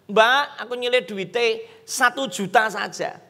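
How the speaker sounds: normal talking voice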